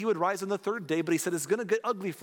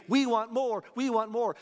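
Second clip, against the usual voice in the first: higher, chanting demand for more; demanding crowd; 6 semitones higher, 3 dB louder than usual. Retold or imitated. Crowd demanding more miracles from Jesus, eager chant. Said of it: "They'd come back and say".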